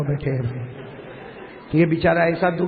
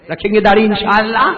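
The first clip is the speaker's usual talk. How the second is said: loud pledge; 3 semitones higher, 9 dB louder than usual. Real, not acted. Crowd-led pledge.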